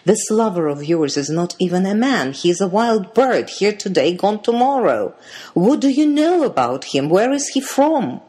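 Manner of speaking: chiding. A narrator's reading. Twins confront their sister about her lover, chiding. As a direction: scold with rising pitch and force. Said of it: "twins chided".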